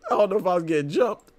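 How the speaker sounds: high quote through laughter; quoting him while laughing. Retold or imitated. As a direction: giggle the words out. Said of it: "He said".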